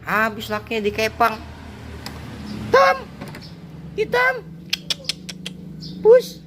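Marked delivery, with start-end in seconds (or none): 2.5-6.4 calling the cat. Real, not acted.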